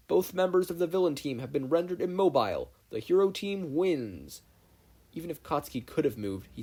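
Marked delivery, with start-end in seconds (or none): 0.1-4.4 higher, louder announcer call; booming announcer. A narrator's reading. All Might announces the winner over intercom, booming. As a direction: boom it over the intercom, slowing on the verdict. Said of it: "the booming voice of All Might blared".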